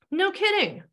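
put-on a delighted older woman pipes up. A narrator's reading. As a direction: squeal it with delight. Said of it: "Rhoda seemed delighted".

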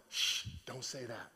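low, soft shushing; hushing. Pretend. Preacher advising Joseph to keep quiet, hushing. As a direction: shush him under your breath.

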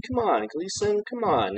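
high, soft coaxing plea; imitated pleading. Man begging Gleason for help, mock pleading. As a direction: wheedle a little, pitch up and volume held back.

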